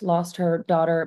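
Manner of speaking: usual speaking voice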